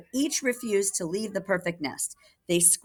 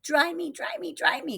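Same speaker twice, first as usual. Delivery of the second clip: put-on high baby whine; tiny voices begging; crying baby chicks; 12 semitones higher than usual. Soggy baby chicks crying at Jack, high and whiny. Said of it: "cried the soggy baby chicks".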